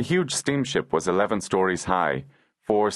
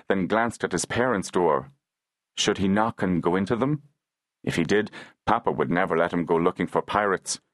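usual speaking voice

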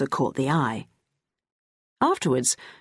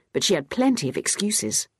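ordinary delivery, nothing marked

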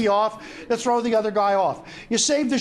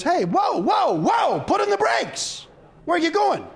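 high shout calling him back; yelling; 8 semitones higher, slower than usual. Acted. Prostitute yelling after koala.

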